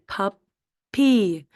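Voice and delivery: PUPPY broken into "pup" and "py", the second drawn out; pup, pause, long stressed pee; split into syllables. Shown as a demonstration. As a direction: say puppy slowly as two separate syllables. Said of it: "get both syllables right".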